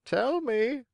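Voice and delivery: high, questioning bird voice; high-pitched curious pigeon; inquiring pigeon. Acted. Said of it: "asked the pigeon".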